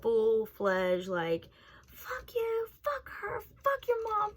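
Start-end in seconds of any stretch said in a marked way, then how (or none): 1.9-4.3 imitated tirade in a much higher voice; mock going off. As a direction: go off on him in a squeaky, held-back yell. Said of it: "I was going off on this man".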